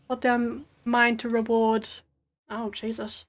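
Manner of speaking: ordinary talk, nothing marked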